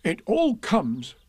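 slow, high-pitched cross bear. A narrator's reading. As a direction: grumble crossly, pitch falling at the end. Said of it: "said Pooh crossly".

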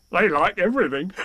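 high, cheerful tiger voice ending in a laugh; bouncy, cheery storybook tiger. A narrator's reading. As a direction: chirp it merrily, then chuckle. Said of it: "said Tigger cheerfully".

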